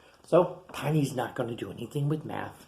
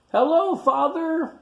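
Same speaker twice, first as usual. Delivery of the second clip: much higher, louder and slower; high little dino greeting; 7 dB louder than usual. Acted. Tiny greets his father, high and loud. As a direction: squeak it up at big dad.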